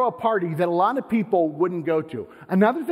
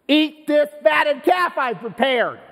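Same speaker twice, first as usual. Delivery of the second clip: higher, slow, shouted royal command; mock tyrant king; 10 semitones higher, 5 dB louder, slower than usual. King pushing reluctant servants to feast, slow shouted command. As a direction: boom it out like a self-satisfied king. King voiced urging party guests.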